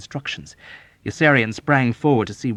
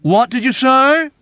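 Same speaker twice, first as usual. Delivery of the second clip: high, loud, slow; shouting Arfie; 10 dB louder than usual. Acted. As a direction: shout back cupping an ear.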